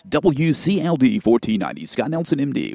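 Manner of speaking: loud, high-pitched top-forty DJ station ID; fake radio voice. Shown as a demonstration. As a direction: put on a phony announcer voice. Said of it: "you had to do this fake voice".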